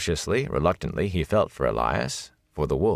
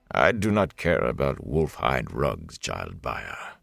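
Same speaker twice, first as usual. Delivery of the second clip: lower, slow, softening to a murmur; gentle rebuke; 5 semitones lower, slower than usual. A narrator's reading. Lord captain gently rebuking his officer, low and slow. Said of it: "The rebuke in the Lord Captain's voice was gentle".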